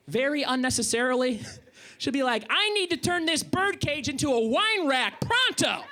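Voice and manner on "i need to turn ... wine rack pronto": higher, slow, insistent wife; imitated demanding wife. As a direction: demand it in a mock wifely voice.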